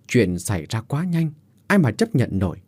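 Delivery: ordinary delivery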